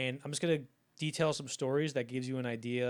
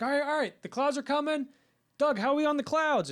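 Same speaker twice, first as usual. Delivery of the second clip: much higher, louder hurried director voice; impatient director; 13 semitones higher, 8 dB louder than usual. Retold or imitated. Director asking the crew about the clouds, high impatient voice. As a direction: play the fussy director, pitched up high.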